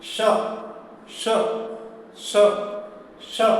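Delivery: श as retroflex ष, curled tongue tip at the roof of the mouth; repeated retroflex श with pauses between. Shown as a demonstration. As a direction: curl the tip back and say श like ष. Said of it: "मूर्धा से सटाइए जीभ को".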